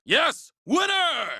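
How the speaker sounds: high, jubilant yelps; victory whoop. Acted. Racer crossing the finish line, whooping. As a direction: cheer the win at the top of your voice.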